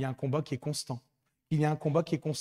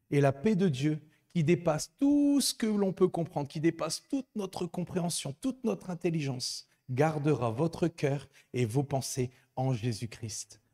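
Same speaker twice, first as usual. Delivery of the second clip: raised, higher scripture reading; fervent scripture reading; 3 semitones higher, 4 dB louder than usual. Acted. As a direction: read the verse with rising fervour. Reads Bible verse with fervour.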